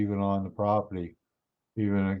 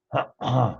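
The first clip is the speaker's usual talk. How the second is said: throat clearing; 2 semitones higher than usual. Real, not acted. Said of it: "Sorry about that".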